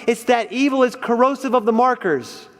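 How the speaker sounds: usual speaking voice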